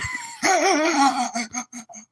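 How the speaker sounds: high cackling villain laugh; villain cackle. Acted. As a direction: cackle high, then let it trail down. Villain quote ends in cackle.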